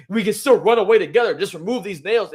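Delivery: desperate pleading. Retold or imitated. Orochi begging Komurasaki to flee.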